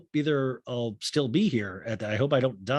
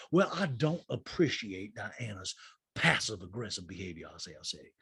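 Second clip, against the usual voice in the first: Sutton; 6 semitones lower, 5 dB quieter than usual. Retold or imitated. Castmate complaining about Diana, mock-prim and hushed. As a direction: sink lower and quieter as it goes. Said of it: "And Sutton goes".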